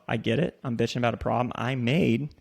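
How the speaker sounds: ordinary delivery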